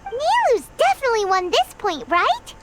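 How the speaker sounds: very high, eager squeak; excited hope. Acted. Supporter sure Nilu won this point, excited. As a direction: pitch it way up, rising on the last word. Supporter whispers hopefully about the debate.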